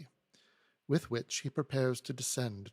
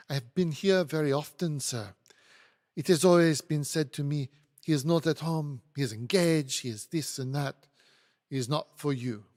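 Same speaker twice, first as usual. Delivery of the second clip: put-on louder, indignant French accent; 2 semitones higher, 7 dB louder than usual. Hortense complains of being turned away, French accent. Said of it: "apologize for my French accent".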